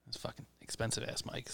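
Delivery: whispered aside. Real, not acted. Aside to his co-host about the mics, whispering. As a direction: lean in and whisper it. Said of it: "aside about mic prices".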